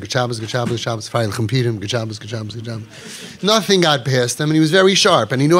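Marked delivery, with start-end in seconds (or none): 0.0-2.9 mumbled greeting. Retold or imitated. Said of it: "he said".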